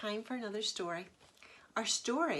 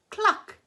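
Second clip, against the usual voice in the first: higher, loud clucking hen; 10 semitones higher, 10 dB louder than usual. Acted. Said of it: "said the hens".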